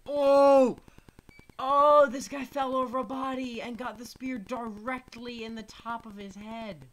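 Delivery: loud, high, shouting in amazement; excited shout. Real, not acted. Streamer shouts as a soldier is speared through the head, excited. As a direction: yell out at the crazy kill. Shouting at spectacular in-game death.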